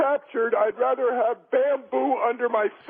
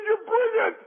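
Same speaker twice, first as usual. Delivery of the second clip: higher, delighted praise; 11 semitones higher than usual. Acted. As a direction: pitch it up, thrilled. Husband delighted in joke.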